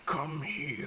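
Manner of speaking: high falsetto whisper; whispered come-on. Retold or imitated. Vegeta beckoning Goku, falsetto whisper. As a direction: breathe it out like a flirt. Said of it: "He's all like".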